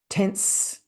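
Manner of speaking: sharp gasp, then a held "tense". Shown as a demonstration. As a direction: demonstrate bracing for trouble. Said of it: "get back that feeling of".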